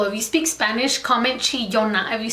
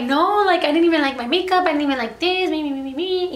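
higher, whiny mock complaint, trailing off; whiny complaining wife; 5 semitones higher than usual. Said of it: "she started complaining to him".

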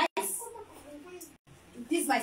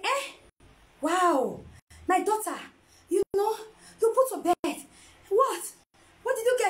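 high-pitched acted amazement; delighted astonishment; 6 semitones higher than usual. Mother hears daughter just gave birth, acted joyful astonishment. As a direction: exclaim it as an overjoyed new grandmother.

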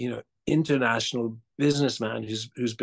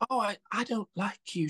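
put-on much higher, fluttery voice of a startled older lady; flustered older woman; 10 semitones higher than usual. Actor imitating supermarket shopper who dislikes his character, high flustered older woman. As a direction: play her as caught off guard and blurting it out. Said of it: "she said".

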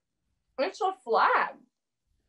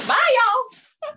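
high, loud Southern goodbye; a sing-song Georgia bye y'all; Georgia accent; 7 semitones higher, 6 dB louder than usual. Real, not acted. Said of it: "What the hell accent was that supposed to be".

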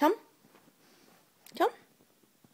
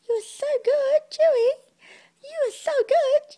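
squeaky praising a dog. Real, not acted. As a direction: gush at the dog in a tiny high voice. Doting on dog.

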